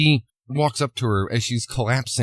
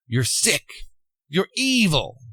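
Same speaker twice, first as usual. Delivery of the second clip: retold revulsion, jumping higher on the second insult; visceral disgust; 5 semitones higher than usual. Woman condemns V after his confession, visceral disgust. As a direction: act out sickened condemnation in short bursts. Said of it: "sickeningly, viscerally".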